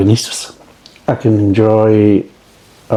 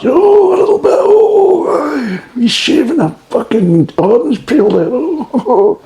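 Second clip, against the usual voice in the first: mocking Jamie Oliver; slower than usual. Mocking Jamie Oliver shaving orange peel, squealing falsetto. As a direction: squeal it like a gushing TV chef. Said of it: "Jamie Oliver fucking keeps shaving".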